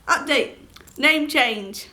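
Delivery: usual speaking voice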